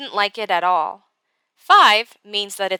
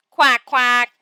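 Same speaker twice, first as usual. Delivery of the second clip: louder, lightly voiced duck; quacking duck; 3 semitones higher, 6 dB louder than usual. A narrator's reading. Narrator voicing a duck near the boat, loud playful quacking. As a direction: read the quacks out loud, storybook style. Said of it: "said the duck".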